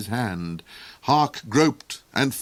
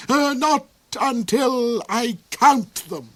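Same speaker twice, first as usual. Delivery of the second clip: villain duke voice; 13 semitones higher, 5 dB louder, slower than usual. A narrator's reading.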